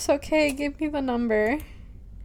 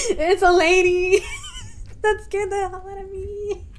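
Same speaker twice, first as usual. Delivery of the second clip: scared laughing; 8 semitones higher, 9 dB louder than usual. Real, not acted. Player shaken by the lady at the door, laughing in fright. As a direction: laugh off the shock loudly.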